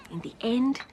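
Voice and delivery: ordinary delivery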